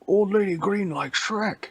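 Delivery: high broken-English monster voice; caveman talk. Acted. Shrek wrongly voiced in broken caveman talk, high. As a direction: talk like a dumb brute. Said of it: "Shrek doesn't talk like that".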